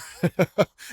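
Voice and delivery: brief bright laugh; short burst of chuckling; amused chuckle. Real, not acted. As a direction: laugh lightly at the joke. Amused by the quoted joke.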